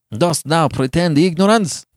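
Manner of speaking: high, loud, accusing question; scowling scorn. A narrator's reading. Giovanni scowling at Beatrice, angrily accusing. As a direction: challenge her angrily. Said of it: "scowling upon her".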